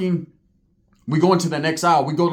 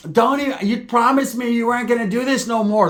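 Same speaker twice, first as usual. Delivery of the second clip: a higher, put-on girlfriend voice, lightly done; mock pleading girlfriend; 5 semitones higher than usual. A girlfriend begs her man not to fight again, a mock pleading voice.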